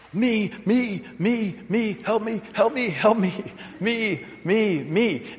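high, soft whining of me me; mock the selfish pleading voice, high and thin; whiny self-centered prayer. Preacher mocking a self-centered prayer, whiny and high. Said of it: "It's a self-centered prayer".

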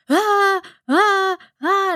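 high, loud imitated shrieks; mock tantrum shrieks; alarm-like screaming. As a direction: scream like a siren in bursts. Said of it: "gritando como una alarma".

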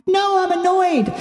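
high, whining inner protest; mock annoyed. Her human side objecting to being told to wait, mock annoyed whine. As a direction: protest in a high, held pitch. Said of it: "And I'm like".

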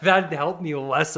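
normal talking voice

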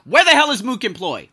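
loud, high outburst of annoyance; retold angry shout; pissed off. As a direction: demand it angrily, voice jumping up. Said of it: "I was pissed".

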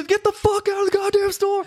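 high-pitched panicked yell; frantic reenacted order to leave; retold freaking out. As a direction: yell high, cutting the visitor off. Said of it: "I was freaking out".

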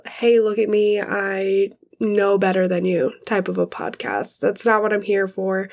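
normal talking voice

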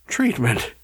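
a touch of hoarseness; hoarse. A narrator's reading. Burford reading the handbook to two worried men, hoarse. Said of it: "said Burford hoarsely".

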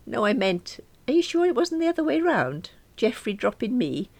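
high, emphatic sarcastic query; pointed high question. Acted. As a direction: needle Mum with mock innocence. Alice needles her mother.

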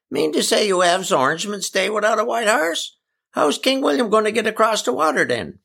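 high, incredulous dialect voice; indignant Eli. Acted. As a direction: challenge them in a high, sustained country voice.

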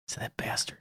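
hushed, voiceless whisper. Retold or imitated. Caddie retelling his reaction to the podcast, whispered. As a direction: whisper it as a private grumble. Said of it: "and I said".

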